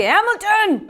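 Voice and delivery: laughing mock call. Laughing retelling of boss calling.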